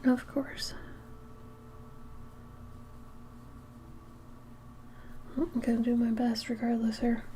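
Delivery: whispered under breath; whispering. Real, not acted. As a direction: mutter it to yourself. Muttering after a mistake.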